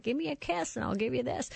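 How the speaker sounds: lightly voiced grandma. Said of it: "this grandma with this more lipstick than lip".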